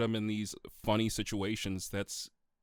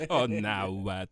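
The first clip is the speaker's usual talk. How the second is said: slow, drawn-out mock European voice; faux European accent; 3 semitones lower, 5 dB louder, much slower than usual. Imitating Death's over-the-top European accent for the co-host. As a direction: stretch it out in a silly fake European accent. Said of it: "This is how he, he speaks in the movie".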